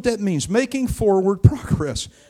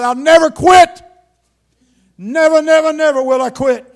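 fervent vow; 8 semitones higher, 9 dB louder than usual. Retold or imitated. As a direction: swear it with full conviction. Young man's vow in the grass.

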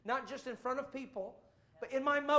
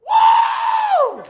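full-throated high whoop; joyful shout; 23 dB louder than usual. Retold or imitated. Preacher and wife on chairs at camp meeting, whooping. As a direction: whoop it out at the top of your voice. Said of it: "we're the only ones shouting".

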